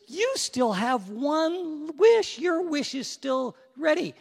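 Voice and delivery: high, loud, drawn-out genie voice; encouraging genie. Acted. Genie reminding last castaway of his wish, encouraging. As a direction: proclaim it high and slow to start.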